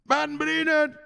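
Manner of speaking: high call of a name. Real, not acted. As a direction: sing out the name. Calling out a friend's name.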